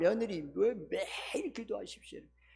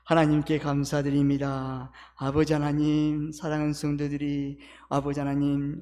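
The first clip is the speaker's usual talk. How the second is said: earnest, steady prayer voice; fervent pastoral prayer; 8 dB louder than usual. Real, not acted. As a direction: pray aloud for the congregation. Leads closing prayer.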